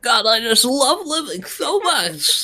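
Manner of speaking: slow, high, gushing cheer; over-peppy. Pretend. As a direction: gush it like you adore life. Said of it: "Gotta sound like you really love life".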